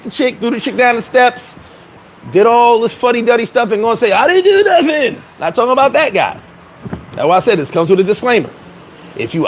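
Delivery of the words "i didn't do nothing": mock innocent whine. Mocking guilty guy's denial.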